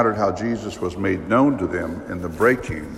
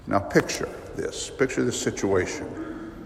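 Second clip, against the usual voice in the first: soft, breathy hush; quiet invitation to picture the scene; 8 dB quieter than usual. Acted. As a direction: draw them in quietly. Preacher invites listeners to imagine.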